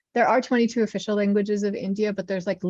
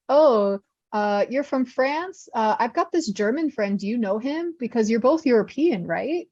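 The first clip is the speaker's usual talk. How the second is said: higher-pitched mock small talk; imitated naive stranger; 4 semitones higher than usual. Stranger quizzing someone from France, mock-naive curiosity. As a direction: play a clueless acquaintance making a silly assumption. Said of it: "it's like if you went somewhere".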